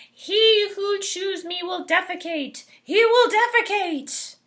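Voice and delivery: high, loud chanting plant voice; talking bulb voice. Acted.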